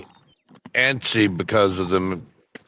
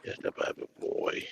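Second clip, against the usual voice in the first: soft hushed whisper; 15 dB quieter than usual. Real, not acted. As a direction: say it under your breath. Whispered remark while watching.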